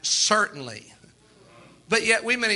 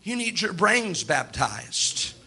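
slow stern rebuke; much slower than usual. Retold or imitated. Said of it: "Brother Branham said".

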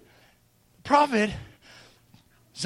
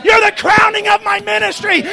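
high, loud, exultant proclamation; so excited; 8 semitones higher, 10 dB louder than usual. Acted. Excited prophet naming the church his bride, exultant. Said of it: "That prophet got so excited".

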